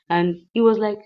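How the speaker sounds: ordinary delivery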